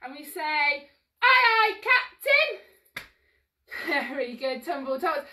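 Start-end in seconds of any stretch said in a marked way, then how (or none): 1.2-3.0 high, loud reply to the captain; crew call. Pretend. Instructor showing children the crew's answer, high loud call. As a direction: sing out like an eager deckhand.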